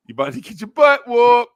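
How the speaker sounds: high, loud mock warning to the puppy; hammed-up warning. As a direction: cry it out like the owner bracing for a fight. Warning repeated, much higher and louder.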